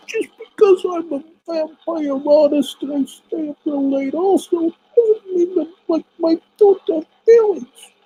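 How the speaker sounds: whiny vampire artist. Acted. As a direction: play a squeaky, wounded vampire painter.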